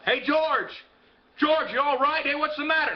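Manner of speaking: acted concerned shout; calling out. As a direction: call to a friend from a distance. Cop calling out to George.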